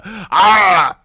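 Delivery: short, loud mock groan; imitated action-hero yell. Host imitating his co-host's voice, mock action-hero yell. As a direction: mimic the legend's big open yell. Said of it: "He's just a freaking legend talking like a".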